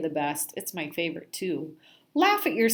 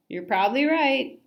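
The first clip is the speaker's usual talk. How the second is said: loud high agreeing fish; 6 semitones higher, 5 dB louder than usual. Acted.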